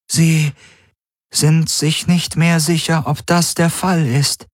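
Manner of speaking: high and slow, labouring over the words; strained AI voice. Acted. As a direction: sound like it costs effort to keep contact. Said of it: "Die Helix klang angestrengt".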